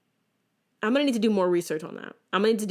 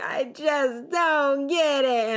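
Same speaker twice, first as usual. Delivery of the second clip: higher, slow, mock wail; mock crying; 7 semitones higher, 4 dB louder, much slower than usual. Little girl on the couch, mock tearful wail. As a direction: whine it like a frustrated crying child. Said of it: "crying on that couch".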